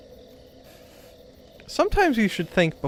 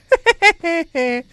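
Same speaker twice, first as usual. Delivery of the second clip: high, loud staccato cackle; put-on high cackle at someone's expense; mock taunting laugh; 11 semitones higher, 6 dB louder than usual. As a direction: cackle in short, high, gloating bursts. Taunting viewers over the ladder.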